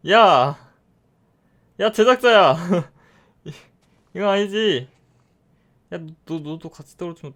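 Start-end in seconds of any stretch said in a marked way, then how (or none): 0.1-2.6 loud, higher call-out; yelling. Real, not acted. Player yelling at the addon maker over a bug, loud shout. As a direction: shout for the creator.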